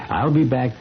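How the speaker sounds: ordinary talk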